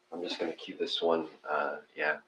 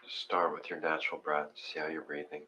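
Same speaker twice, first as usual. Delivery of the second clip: guided meditation; 7 dB quieter than usual. Acted. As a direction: talk them gently into their breath. Leads breathing meditation.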